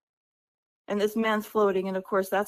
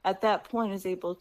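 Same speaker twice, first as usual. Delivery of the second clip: ordinary talk, nothing marked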